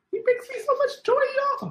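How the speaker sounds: very high, squeaky cooing; adoring high squeal; gushing affection. Real, not acted. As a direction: squeal it in pet-talk voice. Gushing over her dog.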